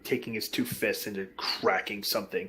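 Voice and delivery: ordinary delivery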